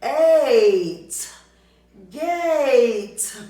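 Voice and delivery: "G-eight" split, then "Gate" high and loud; blending a word, loud. Shown as a demonstration. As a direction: sound out "G-eight", then call "Gate" joyfully. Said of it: "Let's blend that word".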